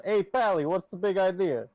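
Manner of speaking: put-on loud, high tough-guy mobster accent; mob boss voice. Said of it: "Levistus is actually just a mob boss".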